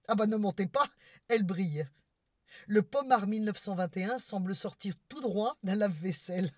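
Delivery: ordinary talk, nothing marked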